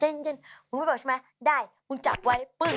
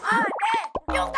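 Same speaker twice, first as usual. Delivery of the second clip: cheer shout; 12 semitones higher, faster than usual. Retold or imitated. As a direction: shout it like leading the crowd. (ตะโกนเชียร์เสียงสูงดัง)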